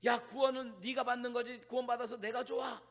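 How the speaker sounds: normal talking voice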